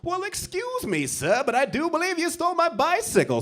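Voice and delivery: very high-pitched imitation of an indignant victim; hammed-up high gentleman voice; mock prissy gentleman. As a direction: pitch it way up, over-polite complaint. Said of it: "Dude chasing you down the street like".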